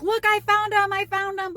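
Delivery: high, loud thrilled call across the store; excited calling out. Retold or imitated. Worker calls her friend across the aisles, excitedly. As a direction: shout it like you just found treasure.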